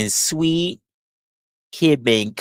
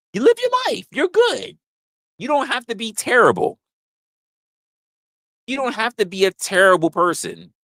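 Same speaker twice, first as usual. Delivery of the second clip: much higher, teasing comfort; high mock reassurance; 9 semitones higher than usual. Comedian teasing the guy in a high voice. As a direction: pitch way up like soothing a child.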